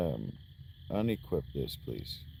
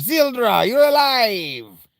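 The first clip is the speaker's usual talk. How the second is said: high, slow, loud cry; character impression; 10 semitones higher, 9 dB louder, slower than usual. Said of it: "That's what she sounds like".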